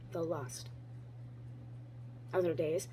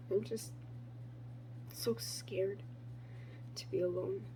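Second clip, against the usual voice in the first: much softer, trailing into a breathy whisper on "to be alone"; fragile whisper; 13 dB quieter than usual. Acted. Poet admitting to herself she fears being alone, whispered. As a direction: let the fear shrink to a whisper. Repeated line, whispered echo.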